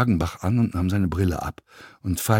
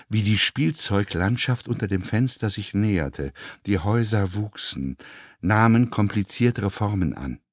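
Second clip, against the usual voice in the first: plain reading voice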